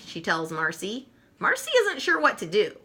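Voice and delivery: plain reading voice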